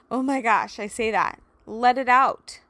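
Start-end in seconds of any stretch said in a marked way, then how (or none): 1.7-2.6 urging release. Real, not acted. Urges listeners to release.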